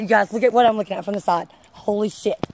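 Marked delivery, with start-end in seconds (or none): none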